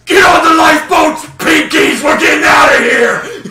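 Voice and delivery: high, loud acted bellow; shouted orders. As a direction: yell commands across a burning deck. Gnoll yells orders from the deck.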